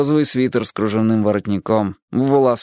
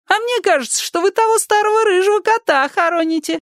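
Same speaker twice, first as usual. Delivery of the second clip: put-on high sly accusing old lady voice; accusing neighbour. A narrator's reading. Neighbour guesses it's the old red cat, high sly voice. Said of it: "neighbour accuses children".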